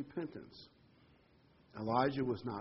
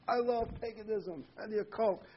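high-pitched gleeful imitation of backsliders; hammed-up delight in a raised voice; mock enthusiasm; 8 semitones higher than usual. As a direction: revel in it, pitched well up. Voicing gleeful mud-diving Israelites.

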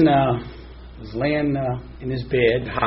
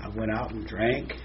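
soft guilty confession; 4 semitones lower, 8 dB quieter than usual. Acted. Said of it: "we'll get a little dramatic".

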